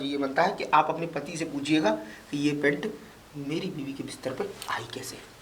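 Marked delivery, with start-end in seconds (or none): 2.3-5.3 much softer, almost whispered; hushed confiding. Acted. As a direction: drop the voice on a shameful detail. Embarrassed about wife's bed.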